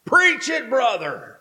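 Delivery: high call from the back of the church. Retold or imitated. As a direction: shout it out like a fired-up churchgoer. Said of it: "this voice calls out, male voice".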